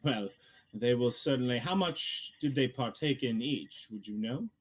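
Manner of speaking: a put-on voice for a game character; hint of a character voice, making conversation; lightly voiced Hammond. Game master plays Hammond conversing.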